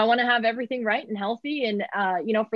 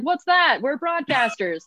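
mock incredulity; 5 semitones higher than usual. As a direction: play baffled at the very idea. Mock reply to sleep advice.

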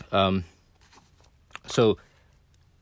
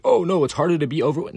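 higher-pitched mimicked protest; mock objection; 6 semitones higher, 4 dB louder, faster than usual. People objecting to him about being skinny, mock protest. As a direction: imitate someone protesting.

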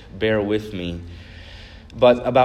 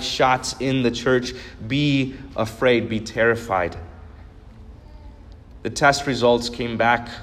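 preaching the world's fear. Acted. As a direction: intone each warning, then drop onto "be afraid".

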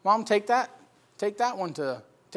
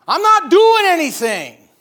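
high, loud, slow mocking whine; mock frustrated whine; 10 semitones higher, 12 dB louder, slower than usual. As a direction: ham up the frustrated complaint.

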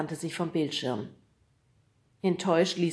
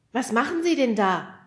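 Fred calls up to the roof, raised and higher; calling up; 6 semitones higher, 6 dB louder than usual. A narrator's reading. Fred calling up to the man on the roof, loud. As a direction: shout the question up to the roof. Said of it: "rief Fred nach oben".

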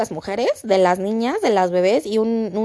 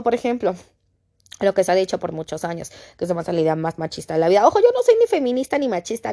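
usual speaking voice

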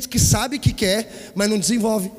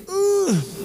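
mock sob; 12 semitones higher than usual. Shame wail after the son's scolding.

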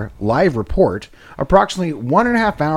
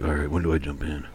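low muttered air marshal; 9 semitones lower, 9 dB quieter than usual. Retold or imitated. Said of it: "He's just like".

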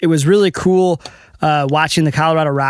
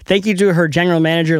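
normal talking voice

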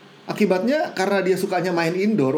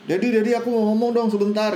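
quick, higher-pitched plea; lightly voiced insistent child; pestering child; 5 semitones higher, faster than usual. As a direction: imitate a kid nagging dad. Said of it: "Terus dia gangguin bapaknya".

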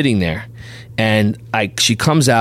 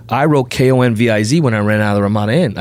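ordinary delivery

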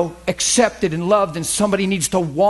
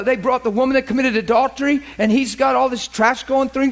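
ordinary delivery

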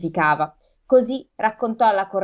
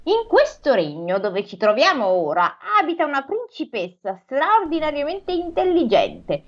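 crow telling tale; 7 semitones higher than usual. Acted. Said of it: "E così raccontò".

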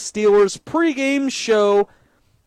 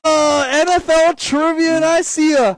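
excited announcer; 6 semitones higher, 4 dB louder than usual. Acted. Announcing the trivia game.

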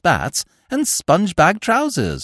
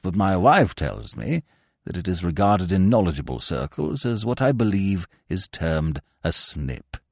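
put-on pompous old lord; 10 semitones lower, 4 dB quieter than usual. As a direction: speak down from great dignity, deep and measured. Pompous peer speaks.

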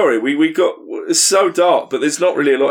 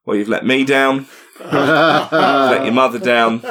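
disappointed dad; 3 semitones higher, slower than usual. Acted. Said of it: "he goes".

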